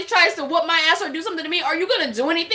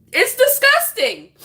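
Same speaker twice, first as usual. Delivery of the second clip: high, outraged exclamation; outraged disgust; 8 semitones higher than usual. Real, not acted. Woman condemning jokes about abuse, high-pitched outrage. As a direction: push the pitch right up in disgust. Repeats her verdict, heightened.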